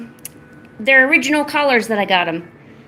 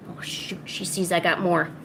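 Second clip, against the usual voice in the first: ordinary talk, nothing marked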